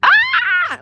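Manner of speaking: sudden high-pitched mock scream; imitated scream. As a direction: mimic the child's scream in one sharp burst. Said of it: "you can hear him, like".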